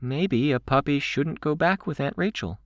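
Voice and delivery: high, tentative suggestion; tentative venture. A narrator's reading. Candy suggesting Rachel leave a puppy, tentatively. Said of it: "Candy ventured".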